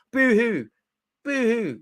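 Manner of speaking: high, sing-song mock sob; mock boo-hoo. Mocking crying players, high fake boo-hoo. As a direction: taunt them with fake sobbing.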